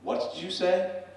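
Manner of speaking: high, slow, affronted question; mock challenge. Barman reacting to the professor's parking insult, high slow challenge. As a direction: draw it out as a challenge.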